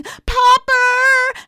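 put-on very high, loud child's yell; panicked yell. A narrator's reading. Girl alone in the train aisle, yelling for Popper. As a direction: yell out for a missing grown-up, stretching the word. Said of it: "She stood in the aisle yelling".

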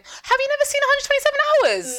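can't believe she hasn't seen it; shocked disbelief. Real, not acted. Friend hasn't seen the film.

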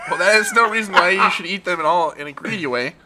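laughing. Acted. Scolding while laughing.

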